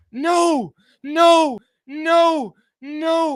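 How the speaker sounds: loud, high repeated no; desperate shouting. Real, not acted. Streamer watching Larson lose the lead, loud pleading.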